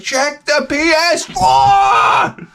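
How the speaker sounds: very high, drawn-out shout; climactic yell. Retold or imitated. Dad ordering his son to look, climactic yell. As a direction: bellow it slowly at the music's crescendo. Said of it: "music is coming to this cres-, crescendo".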